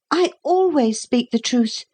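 put-on high-pitched, proud small boy's voice. A narrator's reading. Boy answering the captain, proud. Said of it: "said Philip proudly".

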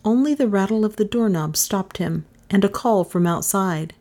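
usual speaking voice

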